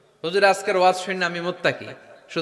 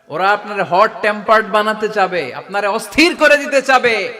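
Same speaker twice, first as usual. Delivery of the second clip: loud, raised voice punching every phrase; full-force preaching; 4 semitones higher, 7 dB louder than usual. Real, not acted. Preacher warning that others will provoke you, preaching at full volume. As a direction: drive it home to a big crowd.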